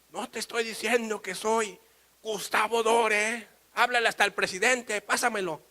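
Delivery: high, loud protest, name dragged out; indignant insistence. Acted. Painter stopped at customs, indignant insistence. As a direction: insist loudly on who you are.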